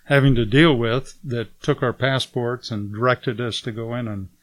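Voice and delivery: ordinary talk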